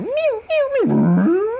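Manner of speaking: high, quick animal mews; imitated elk mews. Hunter mimicking elk as the bull comes in, high mews. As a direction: squeal like elk calling close by. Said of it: "I hear the bull come in".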